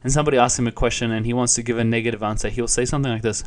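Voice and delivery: normal talking voice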